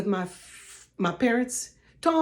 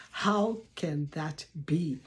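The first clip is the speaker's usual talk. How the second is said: lower, slow, softer musing; quieter doubting echo; 6 semitones lower, 7 dB quieter, slower than usual. Retold or imitated. Viewer musing over the doubtful claim, softer and slow. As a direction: mull it over half to yourself. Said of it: "and you're saying".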